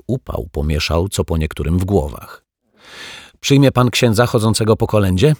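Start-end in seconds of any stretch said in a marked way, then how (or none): 3.4-5.3 put-on higher, louder altar boy voice; sudden blurting. A narrator's reading. Altar boy suddenly asking about a priest's visit, blurting. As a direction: blurt the question out in a raised voice. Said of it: "wybełkotał nagle ministrant".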